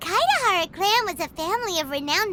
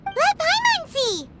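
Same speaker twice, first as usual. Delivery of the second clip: eager; 9 semitones higher than usual. Acted. Eager to look at sword.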